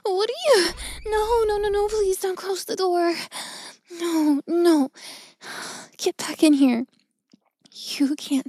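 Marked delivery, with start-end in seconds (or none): 0.0-6.8 panicked. Acted. Caught, begging him stay.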